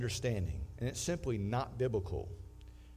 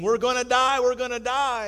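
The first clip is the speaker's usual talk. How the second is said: loud, high panic yell; shipwrecked man screaming in panic; panicked scream; 10 semitones higher, 12 dB louder than usual. Acted. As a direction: scream it like a castaway losing it. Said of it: "one of them started screaming".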